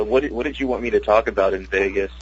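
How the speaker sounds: ordinary talk, nothing marked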